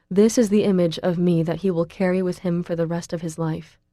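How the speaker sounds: ordinary delivery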